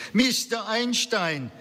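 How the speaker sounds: put-on high, drawn-out call in a Central European accent; old woman's Central European accent, pitched high; strong Central European accent. A narrator's reading. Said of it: "she called out in a strong Central European accent".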